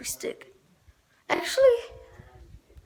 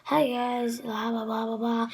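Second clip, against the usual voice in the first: much softer, mumbled greeting; quiet mumble; 2 semitones lower, 8 dB quieter than usual. Shown as a demonstration. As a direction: mumble it like you barely care. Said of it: "I talk like this".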